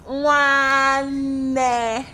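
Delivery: drawn-out, held-long call; mock scream. Shown as a demonstration. Teacher has pupils scream the Igbo word, mock scream. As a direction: scream it out long, without full force. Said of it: "Can you scream it?".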